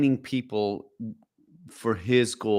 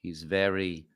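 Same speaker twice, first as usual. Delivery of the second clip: softer, slow and overly precise diction; Rowan Atkinson impression; 5 semitones lower, 8 dB quieter, slower than usual. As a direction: enunciate each word softly and slowly, like a fussy English gent. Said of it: "Rowan sounds like this".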